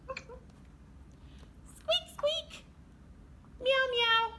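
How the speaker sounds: high squeak and meow; mouse and cat noises. Acted. Teacher voicing a mouse and a cat for the kids.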